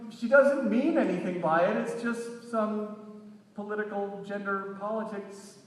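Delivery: loud call, fading at the end; yelling. Retold or imitated. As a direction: shout an excuse, trailing off. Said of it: "I'm yelling".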